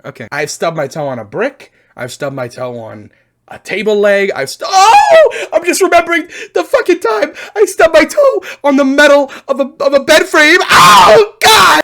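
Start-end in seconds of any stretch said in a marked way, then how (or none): 4.7-5.5 high, loud drawn-out oh; sudden theatrical howl; hammed-up pain howl. Sudden memory of stubbed toe pain. 5.5-11.8 high, loud wailing rant; shouted mock anguish, very high; hammed-up agony. Relives the toe pain loudly.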